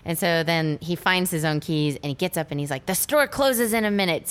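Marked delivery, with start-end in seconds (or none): 2.9-4.3 raised-pitch urgent mock outburst; lightly hammed-up urgency; frantic drinker, imitated. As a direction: imitate someone desperate to reach the store. Acting drinker desperate for liquor.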